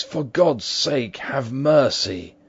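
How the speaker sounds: high, drawn-out begging; desperate plea. A narrator's reading. Cornered man begs for mercy.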